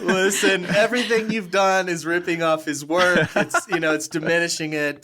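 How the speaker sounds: playful fake cease-and-desist scold; mock stern warning. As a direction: ham up a legal threat, laughing.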